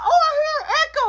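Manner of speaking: high, rapid mimicking voice; teasing little brother. Brother teasing about an echo, high mocking voice.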